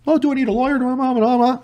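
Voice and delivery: high mock whine of a nervous suspect; whiny suspect. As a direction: squeak it like a jittery suspect asking for a lawyer. Suspect voiced in retold story.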